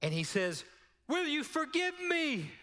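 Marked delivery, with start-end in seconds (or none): 1.1-2.5 pleading yell across a packed church; retold scream. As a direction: call out the plea at the top of your lungs. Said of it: "he screams at the top of his lungs".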